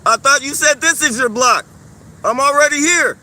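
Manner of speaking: loud, mocking farewells; loud call-out. Real, not acted. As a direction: keep shouting taunts after him. Taunting him as he leaves.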